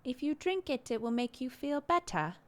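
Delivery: lightly voiced toddler; little girl's voice. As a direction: imitate the toddler sweetly. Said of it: "a sentence that she said".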